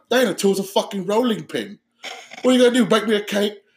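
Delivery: mock them in a put-on British accent; British gangster accent. Gangster sneering at a man with a pipe, British accent. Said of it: "The way British people talk shit".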